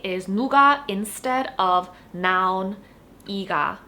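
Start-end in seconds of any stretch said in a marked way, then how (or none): none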